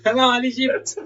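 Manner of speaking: high, loud, laughing rally cry; mock triumphant French. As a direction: cheer them on toward Egypt, hammed-up.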